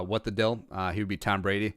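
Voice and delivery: ordinary talk, nothing marked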